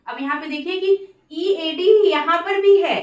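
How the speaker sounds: ordinary talk, nothing marked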